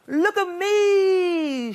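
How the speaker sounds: high, slow, stretched call; shouting. A narrator's reading. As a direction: call out proudly to everyone. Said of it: "shouted Douglas".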